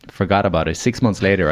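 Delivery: normal talking voice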